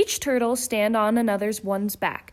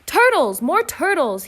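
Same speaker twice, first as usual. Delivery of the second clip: high loud bellow for more turtles; 6 semitones higher, 6 dB louder than usual. Acted. King Yertle bellows for more turtles, loud and high. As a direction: bray it out like a greedy king. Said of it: "he bellowed and brayed".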